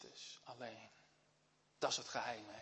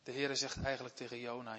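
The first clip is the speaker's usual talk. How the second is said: ordinary talk, nothing marked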